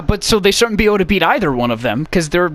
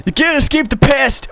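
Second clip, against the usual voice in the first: high strained mock-dramatic cry; hammed-up pained game hero; John Marston impression; 8 semitones higher than usual. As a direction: declare it in an exaggerated high voice. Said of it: "his voice is fun to make fun of".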